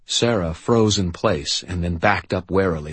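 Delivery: ordinary delivery, nothing marked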